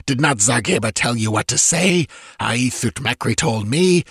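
villain hissing like a cobra; hissing fury. A narrator's reading. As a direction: hiss out the accusations at a traitor. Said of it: "the voice hissed like a cobra".

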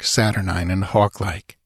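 ordinary delivery

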